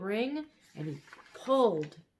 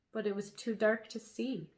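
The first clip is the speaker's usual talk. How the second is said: usual speaking voice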